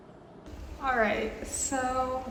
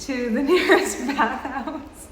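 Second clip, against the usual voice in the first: laughing; 4 semitones higher than usual. Real, not acted. Laughing about hiding in bathhouse.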